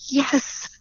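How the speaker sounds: choked-up yes. Retold or imitated. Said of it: "And I went".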